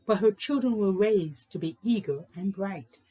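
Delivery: lilting, sing-song read-aloud voice; bouncy rhythmic picture-book reading; storybook narration. A narrator's reading. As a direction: read it like a rhyme to children. Reads a rhyming picture book.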